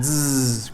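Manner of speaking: soft, long voiced buzz; buzzing zzz. Shown as a demonstration. Teacher shows the continuous z sound. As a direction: hum the zzz steadily. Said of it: "over and over and over again".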